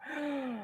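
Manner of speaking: quick surprised intake of breath; sharp gasp. A narrator's reading. Narrator gasping as the hat comes off. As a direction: gasp at the shock.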